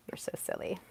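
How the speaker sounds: soft whispered aside; whispering. Real, not acted. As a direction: breathe it as a private whisper. Whispered aside after joke.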